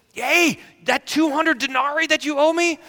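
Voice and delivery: high, loud grab at a debtor; angry demand. Acted. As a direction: shout the claim in his face. Servant grabs a debtor.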